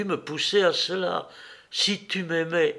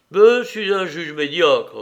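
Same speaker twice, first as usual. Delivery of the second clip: stern; 4 semitones higher, 8 dB louder than usual. Acted. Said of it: "la regardant sévèrement".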